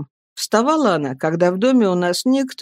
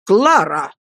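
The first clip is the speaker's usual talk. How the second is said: stern reprimand; 3 dB louder than usual. A narrator's reading. Husband sternly reprimands his wife.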